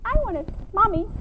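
put-on high, quick child's voice; little kid asking. Child looking up at dad in bed, high and quick. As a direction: ask sweetly like a toddler. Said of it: "the little kid looks up and says".

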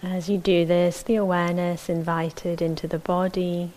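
calm, measured meditation guidance with long silences; unhurried, even voice leaving space between phrases; guided meditation. Acted. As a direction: settle listeners into the body, phrase by phrase.

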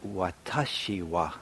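"Watashi wa" slow and careful, each syllable spelled out: wa-ta-shi wa; Japanese phrase. Shown as a demonstration. Teacher showing students the Japanese for I, slow careful pronunciation. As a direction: demonstrate "Watashi wa" slowly in Japanese. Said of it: "You have to say".

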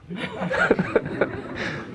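unvoiced high laugh; a soft breathy laugh; amused airy chuckle. Real, not acted. Laughs after mock quote.

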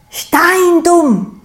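high, loud triumphant cry; grand pronouncement. A narrator's reading. Stroh unveiling his new word to Sau, high and loud. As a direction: blurt the new word out proudly. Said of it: "holt tief Luft und sagt".

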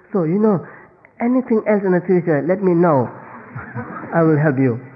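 high, emphatic fake offer of help; insincere offer. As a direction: promise help with overdone eagerness. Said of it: "So also lying".

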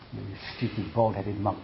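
a muted, half-hearted taunt; an insult quoted under the breath; mock insult, softly. Someone insulting the monk, softly mocking.

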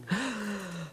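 a sudden sharp intake of breath, acted out; imitated gasp. Meditator recalling a sudden big breath, quick mock gasp. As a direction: gasp as if surfacing from shallow breathing. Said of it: "all of a sudden I'm like".

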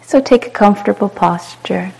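calm, unhurried meditation guide with long pauses; short soothing phrases, falling gently into silence; guided meditation. Real, not acted. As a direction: guide them into spacious ease, letting each phrase settle.

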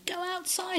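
high, held-back imitation of a yell; mock shouting mum. Mum grabbing her boy by the scruff, mock shout. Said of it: "shouting at him".

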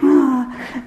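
gushing cooing voice; cooing at animal. Pretend. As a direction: coo at a cute animal. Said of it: "look at the nice porcupine".

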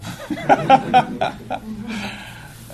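high, loud burst of laughter; amused high-pitched laughing; real laugh. Real, not acted. Laughs at back to school joke.

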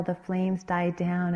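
ordinary delivery, nothing marked

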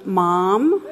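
loud questioning call with a big upswing; imitated caller's voice. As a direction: call out for mom over speakerphone. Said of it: "So you hear this voice going".

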